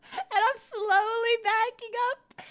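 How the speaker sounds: giggling retelling; laughing. Real, not acted. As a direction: laugh while backing away. Laughing while retelling embarrassment.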